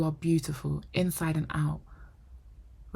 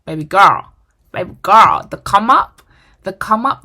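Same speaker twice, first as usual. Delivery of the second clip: passionate shout; 3 semitones higher, 14 dB louder than usual. Real, not acted. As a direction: shout it out like a rallying cry. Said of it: "emotional pep talk to girls".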